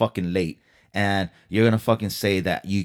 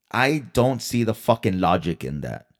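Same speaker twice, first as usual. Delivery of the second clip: usual speaking voice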